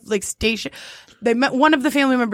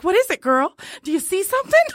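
high, eager dog-owner voice; rising mock urgency, addressing Lassie; talking to a dog; 7 semitones higher than usual. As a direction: ask it as if to an excited dog.

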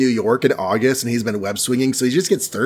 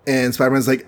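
ordinary talk

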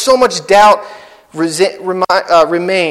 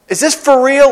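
higher, amazed and eager; excited disbelief; 6 semitones higher than usual. Pretend. Disciples seeing Jesus alive, amazed and excited. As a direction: ask it like it's too good to be true. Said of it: "you're so excited that you're like".

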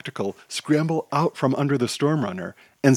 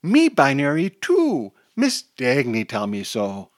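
put-on excited toddler; 2 semitones higher, 4 dB louder than usual. A narrator's reading. Toddler claims to be binary too, excited child's voice. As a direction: start with a toddler's excited burst, then ease down. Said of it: "the toddler says excitedly".

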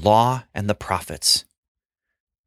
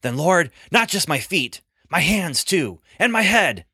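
eager, rising higher to the end; a little too loudly; 8 semitones higher than usual. Acted. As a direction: blurt it out, pitch climbing, eager to please. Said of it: "a little too loudly he said".

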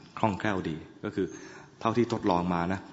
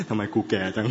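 laughing mock grumble; 4 dB louder than usual. Mock grumble about aging.

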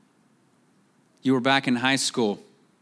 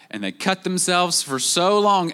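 normal talking voice

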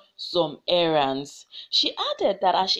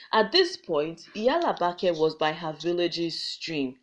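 ordinary delivery, nothing marked

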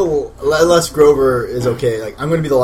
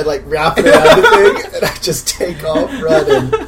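normal talking voice

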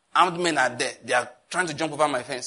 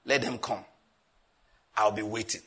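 low, quiet, unbothered confidence; calm confident threat; 7 semitones lower, 4 dB quieter than usual. Retold or imitated. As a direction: say it coolly, sure of yourself. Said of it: "What do I say?".

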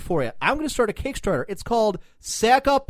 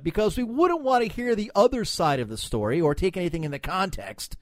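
ordinary talk

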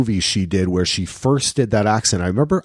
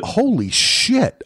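surprised exclamation; 10 semitones higher, slower than usual. Real, not acted. Looking at the cast list.

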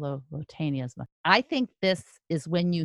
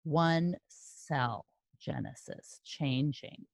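slow, quiet, drawn-out sounding out of the name; muttering the words syllable by syllable; 3 semitones lower, 7 dB quieter, slower than usual. Real, not acted. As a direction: spell it out to yourself, low and slow.

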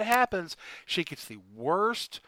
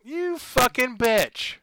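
mock yell; 6 semitones higher, 4 dB louder, slower than usual. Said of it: "her mother is yelling at her".

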